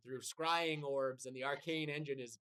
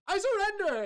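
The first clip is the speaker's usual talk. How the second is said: high, loud desperate yell; shrill cry of a beaten wizard; panicked surrender; 9 dB louder than usual. Acted. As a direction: throw it out like begging for your life. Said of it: "and he goes".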